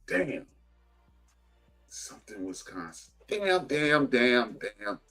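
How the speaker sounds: ordinary delivery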